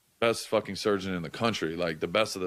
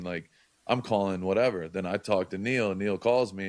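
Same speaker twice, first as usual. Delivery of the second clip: ordinary talk, nothing marked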